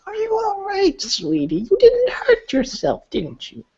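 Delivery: put-on high, doting old woman's voice; high sweet old beggar woman; concerned old woman. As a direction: coo over her like a worried granny.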